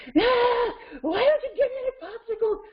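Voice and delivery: high-pitched whiny yell, imitated; yelling child. Child yelling for a popsicle, imitated tantrum. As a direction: wail like a kid demanding a treat. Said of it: "when a child is yelling".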